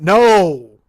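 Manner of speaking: sudden loud barked no; mock shout. Refusing his wife a dog, sudden mock shout. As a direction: slam the idea down, hammed-up.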